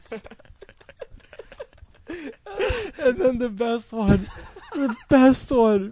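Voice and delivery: high, cracking with laughter; laughing hard. Real, not acted. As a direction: barely get it out for laughing. Laughing through story.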